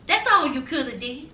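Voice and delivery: normal talking voice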